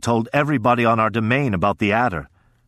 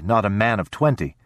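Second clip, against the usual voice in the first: ordinary delivery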